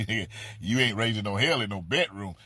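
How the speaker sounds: ordinary talk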